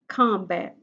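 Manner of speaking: ordinary talk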